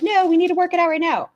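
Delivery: high-pitched imitated tearful pleading; mock crying. [name] begging Emily as she chases her, mock crying. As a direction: wail it in a put-on high voice.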